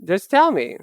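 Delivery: ordinary talk, nothing marked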